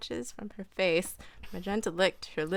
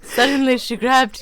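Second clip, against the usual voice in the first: much louder mocking repeat; loud teasing echo; 6 semitones higher, 9 dB louder than usual. Real, not acted. Friend butts in, loudly repeating the reader's line to tease.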